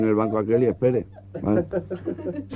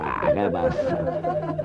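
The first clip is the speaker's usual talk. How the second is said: stoned drawl; 4 semitones lower, 4 dB quieter, much slower than usual. Acted. Stoned legionnaire.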